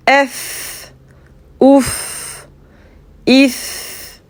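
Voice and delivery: "ouf", "if" en finissant sur un F soufflé net; voyelle puis F. Shown as a demonstration. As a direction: voyelle courte puis F arabe. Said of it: "avec une voyelle devant". (F final bien soufflé)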